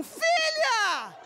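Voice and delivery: mother catching her daughter; shocked shout. Retold or imitated. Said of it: "ela me soltou um".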